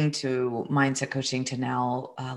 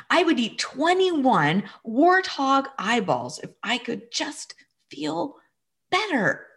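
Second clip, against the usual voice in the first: little boy, much higher, half crying and half playing along; child's voice; 8 semitones higher than usual. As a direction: voice the small son as he starts to joke. Son's voice in retold dialogue.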